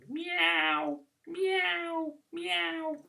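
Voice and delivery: high, soft, slow meows; cat meowing. Shown as a demonstration. Vicar showing children a cat noise, soft meowing. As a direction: mew gently like a cat. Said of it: "they go".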